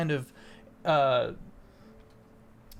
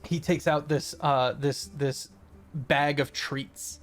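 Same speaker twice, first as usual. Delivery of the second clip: ordinary talk, nothing marked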